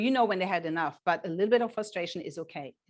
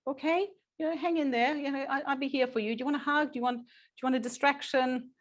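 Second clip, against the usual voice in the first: put-on higher, tender voice for an upset child; caring teacher; 6 semitones higher than usual. Shown as a demonstration. Teacher comforting a crying child, higher and tender. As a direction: lift the pitch and soothe like a kind teacher. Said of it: "You will hear our teachers here say".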